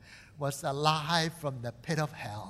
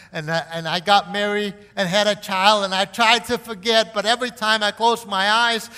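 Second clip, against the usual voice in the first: higher, louder preaching voice; sermon fervour, higher and louder; impassioned preaching; 6 semitones higher, 12 dB louder than usual. Acted. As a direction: drive the story home from the pulpit. Preaching at full force again.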